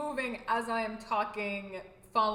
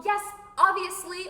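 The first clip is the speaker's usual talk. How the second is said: high, loud, emphatic; emphatic agreement; 8 semitones higher, 9 dB louder than usual. Acted. Acting out agreement with the gesture, loud and emphatic. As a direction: exclaim it as totally self-evident.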